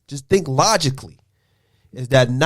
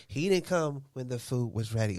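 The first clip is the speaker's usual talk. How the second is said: mocking the wife; 4 semitones lower, 14 dB quieter than usual. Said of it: "I don't talk like that".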